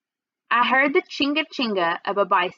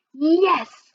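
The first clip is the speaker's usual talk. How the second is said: excited cheer; 6 semitones higher, 3 dB louder than usual. Acted. Child cheers, fist pump.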